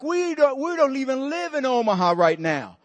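high voice sliding downward; acted wailing protest; mock tearful wail. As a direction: imitate an overwhelmed, weeping wife. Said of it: "She starts crying".

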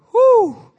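high, loud whistling whew; nervous whew. Retold or imitated. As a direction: blow out a scared whew. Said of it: "And I'm like".

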